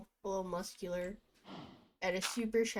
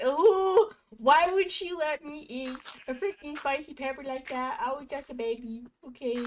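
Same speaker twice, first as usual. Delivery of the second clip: mock whiny complaint; 5 semitones higher, 7 dB louder than usual. Mock complaint about mom.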